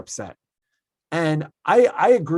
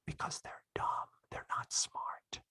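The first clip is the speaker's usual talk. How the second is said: hushed whisper; 16 dB quieter than usual. Real, not acted. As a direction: whisper the blunt verdict, spacing it out. Hushed emphasis on a blunt verdict.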